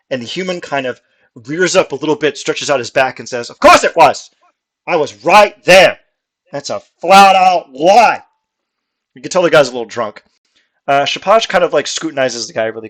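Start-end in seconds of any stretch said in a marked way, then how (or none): 3.5-8.2 loud, higher, slow protest; slowed, hammered-out furious insistence; drunken indignant yell. Acted. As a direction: pound every word like an offended drunk. Drunk human insists angrily.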